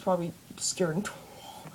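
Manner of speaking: soft unvoiced aside; whisper. Real, not acted. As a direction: whisper it under breath.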